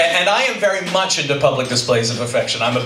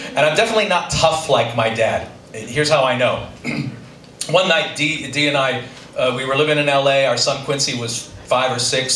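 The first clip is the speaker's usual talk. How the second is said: ordinary delivery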